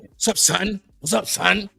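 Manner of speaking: deep raspy voice. Retold or imitated. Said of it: "Deep ass raspy voice".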